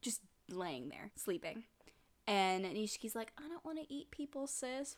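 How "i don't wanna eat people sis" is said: put-on small, soft, higher child voice; lightly voiced reluctant little brother; little kid. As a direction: plead softly like a little kid. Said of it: "first him as a little kid".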